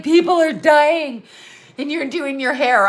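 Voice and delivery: a woman scolding her for doing her hair in lockdown, mock outrage; mock outraged scold. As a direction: play the shocked prude yelling at her. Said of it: "she's like".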